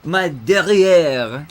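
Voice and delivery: slow, drawn-out mock French accent; exaggerated fake French; bad French accent. Shown as a demonstration. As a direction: ham up a French accent, savouring each syllable. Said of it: "my bad French accent".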